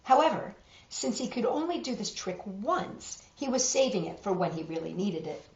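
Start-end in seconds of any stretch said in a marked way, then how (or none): none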